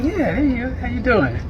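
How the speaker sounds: a lightly voiced gushing "hey, how you doing"; mock friendly greeting. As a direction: play the overly eager greeter. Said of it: "I was like".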